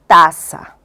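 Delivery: taza said loud and sharp, T in place of the K of casa; child's substitution. Retold or imitated. Therapist imitating a child naming a house picture, loud: taza. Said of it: "Él me dice".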